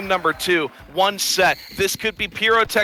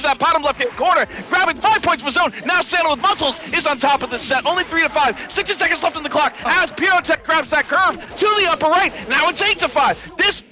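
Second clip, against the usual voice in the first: high, fast hyped commentary; excited play-by-play; 9 semitones higher than usual. Real, not acted. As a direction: call it live, pitch up.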